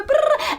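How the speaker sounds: playful high trill; high brrr sound. Acted. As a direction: trill your lips brightly. Wiggle sound effect.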